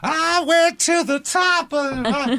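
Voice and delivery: very high, screechy mock street rant; crazy woman swearing. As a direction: imitate a wailing, singing, cursing crazy lady without full volume. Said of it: "swearing at cops".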